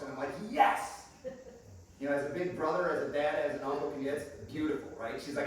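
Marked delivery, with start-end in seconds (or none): 0.5-1.0 high, loud celebrating yes; triumphant cheer. Retold or imitated. Big brother glad his sister hates dating, triumphant cheer. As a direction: cheer it like a win.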